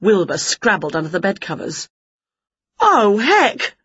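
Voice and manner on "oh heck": high dismayed oh heck; dismayed cry. A narrator's reading. Winnie seeing herself in the mirror, high dismayed cry. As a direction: caught by a nasty shock. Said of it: "cried Winnie".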